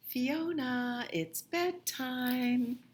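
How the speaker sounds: bright picture-book voice; storybook reading. Acted. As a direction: read it like to a toddler. Reads title to children.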